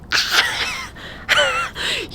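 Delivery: breathless giggly laugh; excited laugh. Real, not acted. Traveller thrilled about first trip to Asia, excited laugh.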